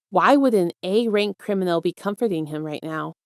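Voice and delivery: usual speaking voice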